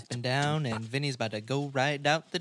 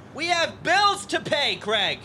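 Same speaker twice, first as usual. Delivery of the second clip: angry yell; 11 semitones higher, 5 dB louder than usual. Acted. Acted quarrel, Pam yells.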